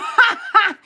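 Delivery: bright burst of real laughter; real laughter at the viewer's joke; laughing. Real, not acted. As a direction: let a loud laugh burst out.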